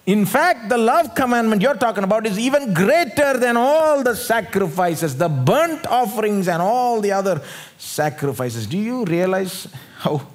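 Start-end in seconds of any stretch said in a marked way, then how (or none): 0.1-8.7 emphatic retelling. Acted. Preacher voices the scribe's reply.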